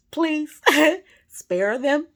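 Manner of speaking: usual speaking voice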